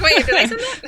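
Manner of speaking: high, loud laughing double-take; surprised, laughing. Retold or imitated. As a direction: laugh through a startled question. Imitating her own surprised reaction.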